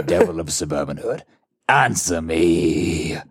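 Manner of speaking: very low, very slow ominous voice; menacing texter. Acted. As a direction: drag each word out like a sinister villain. Reads villain's menacing text message.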